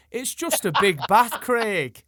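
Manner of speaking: loud, high, slow mock-Geordie punchline; imitated Geordie accent. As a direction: belt it out slowly as a Geordie double act. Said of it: "I'll do the last bit in your accent".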